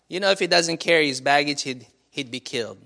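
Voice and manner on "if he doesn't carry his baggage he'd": ordinary talk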